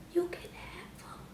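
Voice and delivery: whispered, slow giving up; whispered surrender; resigned whisper. Retold or imitated. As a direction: whisper it, totally done. Handing over phone, resigned.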